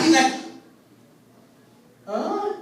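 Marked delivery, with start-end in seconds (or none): 2.1-2.5 put-on high, puzzled little kid huh; confused huh. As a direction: sound baffled like a small boy. Son's confused reaction.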